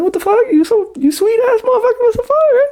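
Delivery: very high, squeaky disbelief with a laugh; stunned squeal. Retold or imitated. Storyteller reacting to friendly Connor, high laughing disbelief. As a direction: squeal in amazed disbelief at how sweet he is.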